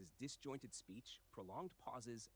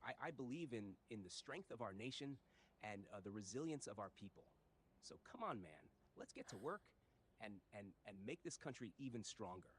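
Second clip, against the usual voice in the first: usual speaking voice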